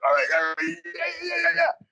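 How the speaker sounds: high excited cheering; rowdy crew cheer. Acted. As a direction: whoop them up. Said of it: "the rest of the crew's like".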